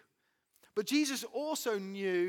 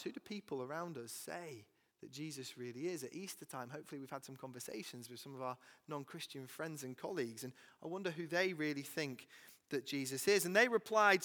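ordinary talk, nothing marked

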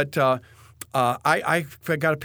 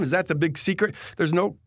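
usual speaking voice

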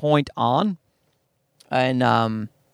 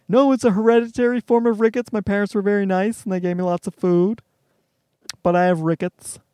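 defensive explanation; 3 semitones higher than usual. Retold or imitated. Defending his parents, voiced himself.

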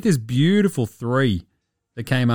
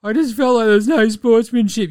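high, louder sulky whine; whiny mock quote; 9 semitones higher, 5 dB louder than usual. Host mocking the sore loser, high whiny impression. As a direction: sneer it like a sulking child. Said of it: "you idiot?".

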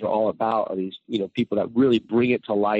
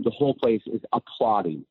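ordinary talk, nothing marked

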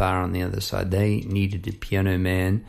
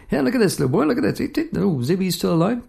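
put-on much higher, sweet voice for a little boy; talking to a child; 10 semitones higher, faster than usual. Showing the boy the bird is fine, high sing-song voice. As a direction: coax the kid like a cheery shop boy.

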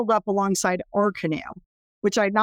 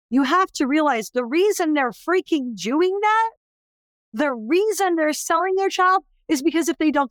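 impassioned plea; 8 semitones higher than usual. Real, not acted. Passionate defence of parents.

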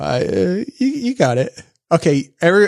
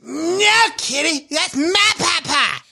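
high, slow bratty cartoon kid; awful but committed Cartman voice; Cartman impression; 12 semitones higher, much slower than usual. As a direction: whine it like a spoiled cartoon boy. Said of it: "So how about something like this?".

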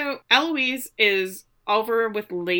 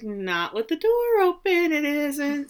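slow, higher, teasing retort; coy sassy tease; 7 semitones higher, slower than usual. Retold or imitated. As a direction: tease him slowly, eyebrows up. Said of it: "And she's like".

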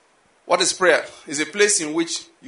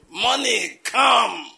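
mock shout at money; 5 semitones higher, much slower than usual. Preacher mimicking churchgoers, calling money in a mock shout. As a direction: bark it out like a greedy prayer.

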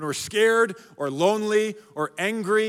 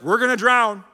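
mock panic; 4 semitones higher, 4 dB louder than usual. Disciples panic in the storm.

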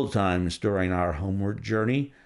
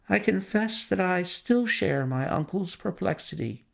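a higher, gentle niece's voice; lightly voiced young woman; 7 semitones higher than usual. Niece answering Holmes's question, lightly voiced higher. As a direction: answer Holmes politely, a little lost.

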